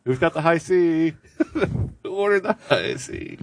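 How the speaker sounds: high, drawn-out call; waiter calling out. Pretend. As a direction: call out the order at a bar.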